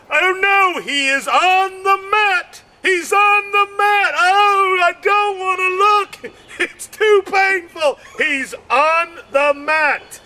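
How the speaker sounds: high, pained cry of a spectator; mock horror. Spectator wincing at a fighter down on the mat. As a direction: squeal that you can't bear to look. Comic voicing a horrified spectator.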